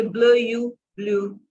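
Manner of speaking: ब्ल यू split slowly, then ब्लू blended; sound out blue. Shown as a demonstration. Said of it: "इसको हम यू बोलते हैं". (ब्ल यू को तोड़कर फिर ब्लू जोड़कर बोलें)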